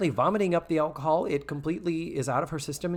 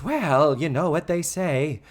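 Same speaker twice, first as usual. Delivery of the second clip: louder, slow, stretched-out punchline delivery; Mrs. Garrett imitation; 3 semitones higher, 6 dB louder, slower than usual. Host acting out Mrs. Garrett's zinger, slow hammed-up voice. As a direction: land the zinger slowly, pitched up.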